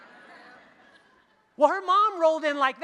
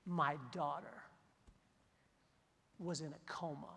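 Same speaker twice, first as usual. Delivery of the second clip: dramatic whisper; 9 semitones lower, 17 dB quieter than usual. Retold or imitated. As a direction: drop to a hush for the big reveal. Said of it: "whisper shout at you".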